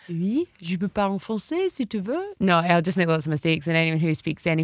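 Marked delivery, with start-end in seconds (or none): 0.1-2.3 showing off some spoken French; French accent. Shown as a demonstration. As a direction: demonstrate your French accent on request. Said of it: "Show me all the".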